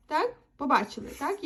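ordinary delivery

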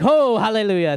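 hammed-up hallelujah; mock jubilation. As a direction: exclaim it like a thankful churchgoer. Acted reply to God's reminder.

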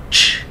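"Ch" alone as a burst of air; đánh vần âm ch. Shown as a demonstration. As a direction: puff out "Ch" with no vowel. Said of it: "các bạn đánh vần trước". ("Ch" chỉ bật hơi, không có ơ)

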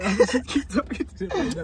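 high, loud, slow laughing words; laughing while talking. Real, not acted. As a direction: laugh through the line. Laughing while pitching the joke.

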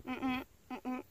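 soft, embarrassed humming imitation; half-spoken reply. Farmer friend unsure where to put himself, imitated half-voiced hums. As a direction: mumble wordless hums, barely committing. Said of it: "à demi-mot, il me disait".

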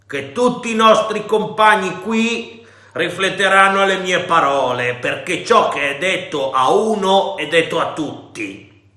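pirate raising his voice to the crew. A narrator's reading. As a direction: raise your voice so every man hears. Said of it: "e qui alzò la voce".

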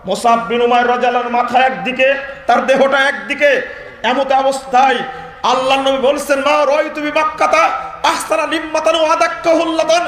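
high, forceful sermon delivery; preaching at full force. Acted. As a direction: declaim it loudly to the whole crowd. (উঁচু গলায় জোরালো ওয়াজ)

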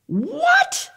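high squeaky what, a real double take; surprised what. Acted. Teacher startled to find a second story, surprised. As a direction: jolt up in disbelief.

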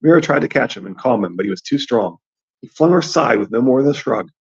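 ordinary delivery

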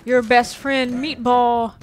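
loud, slow, fake-cheerful greeting; mock-friendly monster. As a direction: lure the victim in as his pretend best friend. Monster luring the victim.

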